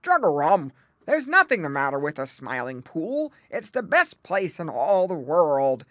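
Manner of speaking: loud, gruff croaking character; gruff old frog. A narrator's reading. Grandfather Frog dismissing the youngsters' alarm, gruffly. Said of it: "replied Grandfather Frog gruffly".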